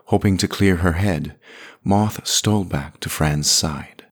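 usual speaking voice

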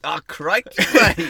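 loud, hammed-up Australian; big cartoon Australian exclamation; Australian accent. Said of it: "do the Australian".

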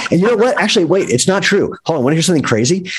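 high, eager build-up; suddenly eager to share; excited. Real, not acted. Excited interruption before news.

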